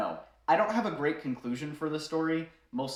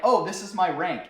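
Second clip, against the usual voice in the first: mock eager boast, louder and higher; eager showing off; 5 semitones higher, 7 dB louder than usual. Drunk guy shoving his phone at the colonel, eager. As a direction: push it in his face proudly. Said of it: "starts trying to show him".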